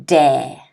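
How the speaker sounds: "dare", rhyming with air, dropped low; one syllable, one stress. Shown as a demonstration. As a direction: DARE as a single low, falling beat. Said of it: "One syllable, one stress".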